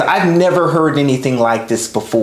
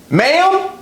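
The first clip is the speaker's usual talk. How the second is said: "Ma'am?" pitched far higher, with a sharp rise; questioning ma'am; 13 semitones higher than usual. Retold or imitated. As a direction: mimic her sharp, high questioning "Ma'am?". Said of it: "she always put extra emphasis on the word ma'am".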